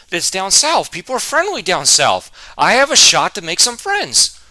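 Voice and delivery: high, loud, eager hope; pumped about a fresh start; excited. Retold or imitated. Retold own excited thought.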